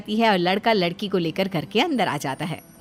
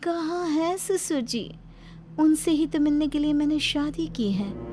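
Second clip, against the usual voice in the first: eerie snake-bride; 8 semitones higher than usual. Acted. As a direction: draw it out with fixed intent. Voices the fixated snake bride.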